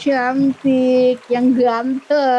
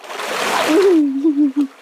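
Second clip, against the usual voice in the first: long giggle. Real, not acted. Laughs at monkey counting money.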